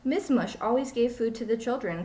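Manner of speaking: ordinary delivery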